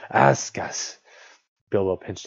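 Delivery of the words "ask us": loud hissing creature demand; insistent creature rasp; Gollum. A narrator's reading. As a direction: press harder, hissing the s. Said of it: "or Gollum asked".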